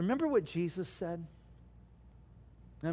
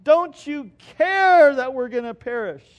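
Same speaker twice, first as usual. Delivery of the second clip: high, loud desperate plea; panicked cry; 9 semitones higher, 12 dB louder than usual. Acted. Disciples crying out to the sleeping Jesus in panic. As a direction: cry out for rescue. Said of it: "they say".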